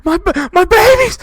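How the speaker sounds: mother screams as the raiders attack; screaming mother. Acted. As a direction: shriek for your children. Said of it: "your mother screams".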